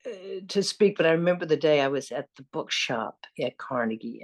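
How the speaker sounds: ordinary talk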